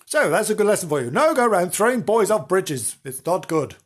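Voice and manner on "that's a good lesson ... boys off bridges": ordinary delivery, nothing marked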